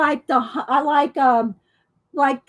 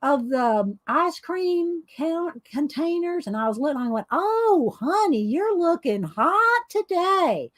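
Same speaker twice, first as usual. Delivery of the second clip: higher Southern drawl with swooping, stretched vowels; Chandra Pierce impression; 4 semitones higher than usual. Comedian retelling her grocery store strut, imitated little-girl Southern drawl. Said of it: "she kinda has a little girl voice, but she's very Southern".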